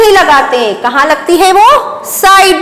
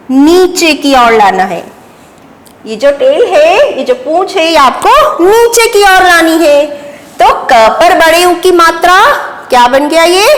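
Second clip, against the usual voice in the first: ordinary delivery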